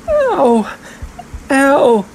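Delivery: high-pitched yelp of pain; loud, high "ow"; cried-out ow. A narrator's reading. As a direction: younger girl crying out in pain. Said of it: "she cried".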